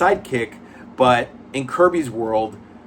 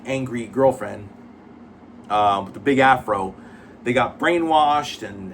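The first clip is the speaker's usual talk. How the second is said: normal talking voice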